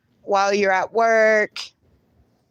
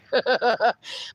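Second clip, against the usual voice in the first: brief string of chuckles; light giggle after an apology; laugh. Real, not acted. Amused after joking apology.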